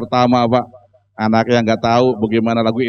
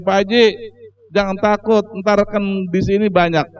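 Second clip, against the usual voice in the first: put-on higher, insistent old man voice; demanding old haji; 5 semitones higher than usual. Preacher retelling the haji's demand, higher insistent old man. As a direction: insist on wanting his children.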